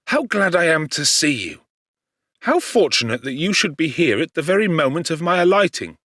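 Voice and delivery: a lightly voiced young friend, glad and eager; Clerval's eager greeting. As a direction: call out to him, overjoyed to see him.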